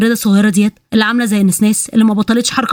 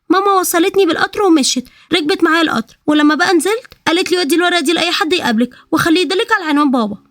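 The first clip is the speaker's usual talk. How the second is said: put-on a small child explaining her trip, pitched way up; little girl voice; 7 semitones higher than usual. Little girl telling the men how she arrived, high childlike voice.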